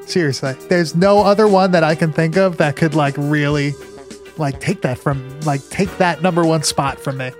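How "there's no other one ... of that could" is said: ordinary delivery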